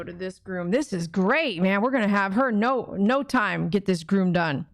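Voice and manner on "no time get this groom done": ordinary delivery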